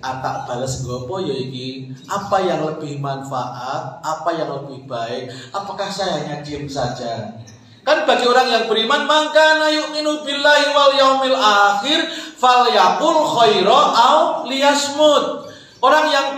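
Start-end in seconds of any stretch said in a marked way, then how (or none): 0.0-7.4 low, soft musing to oneself; responsive self-questioning. Acted. Patient person pondering a reply, responsive self-questioning. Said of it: "kalau responsif itu ada omong A". 9.1-15.5 measured Arabic recitation, pitched higher; hadith recitation. Real, not acted. Preacher quoting the Prophet to congregation, hadith recitation.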